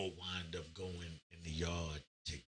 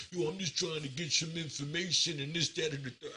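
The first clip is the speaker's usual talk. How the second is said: high, put-on innocent voice; mock innocent voice; 8 semitones higher than usual. Gang member feigns innocence in a high mock voice. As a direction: play the guy acting harmless. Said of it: "you know, like".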